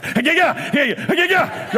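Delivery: mock bayonet-thrust yells. Husband imitates wife vacuuming like bayonet practice, mock thrusting yells. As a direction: yelp with each imagined thrust. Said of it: "like she's doing bayonet practice".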